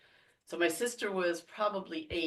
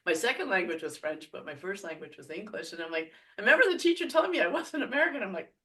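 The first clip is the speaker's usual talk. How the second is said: ordinary talk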